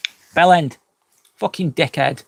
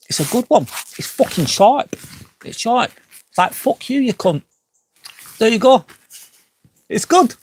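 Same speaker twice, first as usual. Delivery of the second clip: gleeful relish; 8 semitones higher than usual. Real, not acted. Amused, relishing a favourite swear word.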